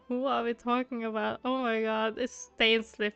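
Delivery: ordinary talk, nothing marked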